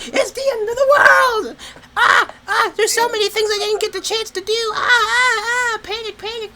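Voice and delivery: high, loud hammed-up panicking wail; mock panic. Mocking classmates who panic about Corona, hammed-up high wail. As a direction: squeal like the world is ending, overdone. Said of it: "people were like".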